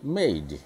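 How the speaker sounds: made drawn out slowly with long ay. Shown as a demonstration. Teacher slowly modelling made. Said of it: "एमएडीई made".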